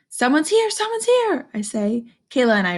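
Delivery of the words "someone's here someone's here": excited dog. Acted. Dog hears the doorbell.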